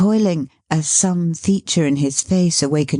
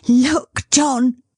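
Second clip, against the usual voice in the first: exultant; 8 semitones higher than usual. Acted. Caleb showing his son to the carrier, exultant. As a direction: crow with joy. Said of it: "said Caleb exultingly".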